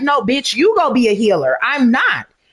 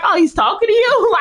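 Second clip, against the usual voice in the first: mock-innocent question pitched much higher; imitating Dre; 13 semitones higher than usual. Retelling her son's teasing question, pitched high. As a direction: parrot his sly question up high. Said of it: "he was like".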